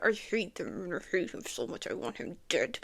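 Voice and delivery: low, soft grumble; muttered hatred. Acted. Viewer hating the blaming father, low muttered loathing. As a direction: mutter it low under the breath.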